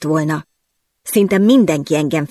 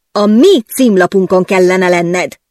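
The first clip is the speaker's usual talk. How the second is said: louder, slow yelled reproach; furious yell; 5 dB louder, slower than usual. Acted. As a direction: bellow it red-faced. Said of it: "üvöltötte totál elvörösödött fejjel". (dühösen, lassan üvöltve)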